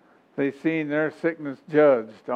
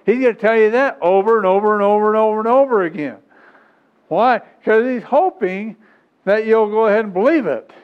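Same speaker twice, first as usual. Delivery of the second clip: raised, driving preacher voice with rapid-fire repetitions; full-force preaching; 4 semitones higher, 4 dB louder than usual. Real, not acted. Preacher warning congregation about the devil's repeated lies, full-force preaching. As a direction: hammer it home to the congregation, louder and higher.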